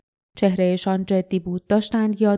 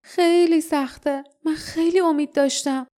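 grieving and high-pitched; sorrowful murmur; 7 semitones higher than usual. A narrator's reading.

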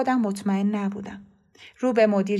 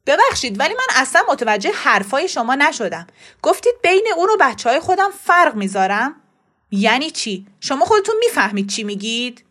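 loud, affronted questioning; indignant father demanding an explanation; upset; 4 semitones higher, 7 dB louder than usual. A narrator's reading. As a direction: challenge him, offended and loud. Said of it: "ناصر با ناراحتی گفت".

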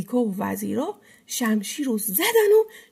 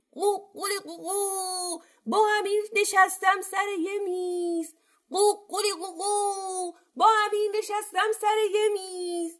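high sing-song crow, stretched out; defiant rooster; 9 semitones higher than usual. A narrator's reading. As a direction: crow a cheeky boast. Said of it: "بازم خروس دست بردار نبود". (آواز زیر و کشیده خروس)

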